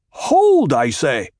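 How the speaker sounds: high, loud order to stop; commanding shout. A narrator's reading. Paul stopping his men pressing in, commanding shout. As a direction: halt his own men at once.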